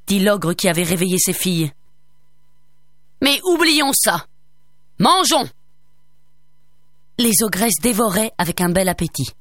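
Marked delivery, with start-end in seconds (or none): 3.2-5.5 higher, slow then booming; hearty call to the table; jovial ogre. A narrator's reading. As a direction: ogre waving it off, hungry. Ogre calls to eat.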